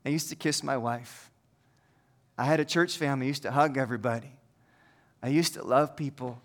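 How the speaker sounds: soft, low, heavy with loss; hushed lament of a man who lost everything; sorrowful leper plea. Pretend. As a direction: speak as a grieving outcast, quietly. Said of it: "and I would say".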